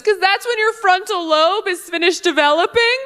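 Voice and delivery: high, insistent know-it-all woman; mock heckler explaining herself; imitated audience heckler. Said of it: "yell at me from the audience".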